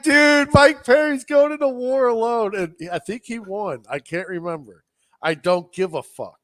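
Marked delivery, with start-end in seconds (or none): 0.0-2.5 high, loud fan outburst; amazed excitement. Retold or imitated. Podcaster reliving his reaction to the fighter going alone, amazed. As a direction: re-enact a fan blown away, pitched way up.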